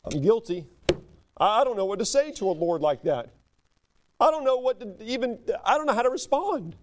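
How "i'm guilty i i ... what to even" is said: normal talking voice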